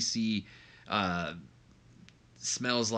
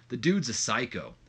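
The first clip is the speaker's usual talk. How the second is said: usual speaking voice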